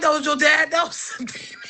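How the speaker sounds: high laughing mock mom voice. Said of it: "She was like".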